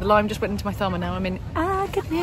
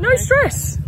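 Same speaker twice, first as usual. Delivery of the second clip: excited squeal; 7 dB louder than usual. Acted. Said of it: "We're in no stress as well".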